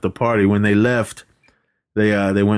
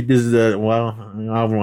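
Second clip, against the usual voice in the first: ordinary talk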